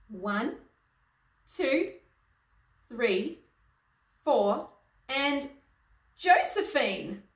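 suspenseful count, bursting out on the name; building countdown. A narrator's reading. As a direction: count in the star with rising excitement. Counting in the star's stage entrance.

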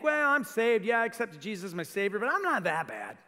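high, loud, breezy mock confidence; smug self-assured believer. Pastor mocking a self-satisfied believer, high and breezy. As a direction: lampoon the smug churchgoer who thinks he's fine.

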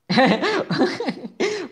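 a high, bursting laugh. Real, not acted. Streamer laughs at a chat message. (ऊँची खिलखिलाहट)

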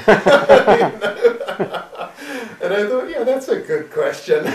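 loud, high laughing speech. Real, not acted. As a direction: talk while laughing hard. Laughing through the story.

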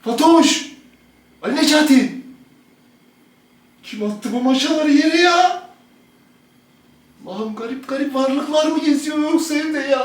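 loud bewildered calls; calling out. Real, not acted. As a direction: shout for them through the house. Dad calling and exclaiming. (şaşkınca yüksek sesle seslenme)

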